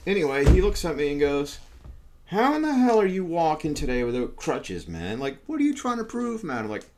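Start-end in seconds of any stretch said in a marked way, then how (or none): 2.3-6.6 higher-pitched mock disbelief; imitated incredulity. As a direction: act out an astonished physio questioning a patient. Acts out physio's incredulous questioning.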